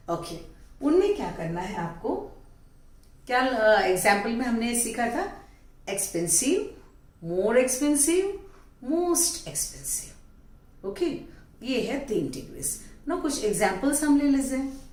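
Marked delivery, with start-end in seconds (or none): 5.9-10.1 very slow, each word drawn out; slow demo. Shown as a demonstration. Teacher showing the class the three degrees, very slowly. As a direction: stretch every word so the class can follow. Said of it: "teacher demonstrates degrees slowly".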